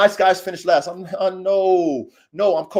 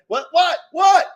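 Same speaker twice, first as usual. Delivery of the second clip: loud what; 9 semitones higher, 5 dB louder, slower than usual. Real, not acted. Reacting loudly to someone off camera.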